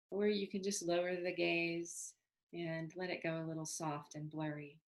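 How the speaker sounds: calm, unhurried meditation guidance with long pauses; even, settled meditation voice; guided meditation. Acted. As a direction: gently lead them into body awareness, leaving space between phrases.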